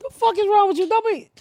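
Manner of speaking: squealed high in mock outrage. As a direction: shriek it high and indignant.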